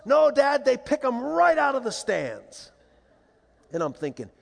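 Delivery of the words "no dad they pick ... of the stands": put-on high, loud, drawn-out boyish exclamation; emphatic kid. Son correcting dad about umps, imitated high-pitched emphatic excitement. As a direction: spell it out loudly like an amazed child.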